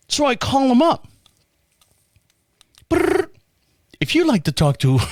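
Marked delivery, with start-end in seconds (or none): 2.9-3.3 high trilled ringing noise; imitated phone ring. Caller imitating the phone as he calls them up, high trill. 4.0-5.0 canned recorded-menu voice; automated phone menu. Retold or imitated. Phone system answering a bank customer's call, canned menu voice.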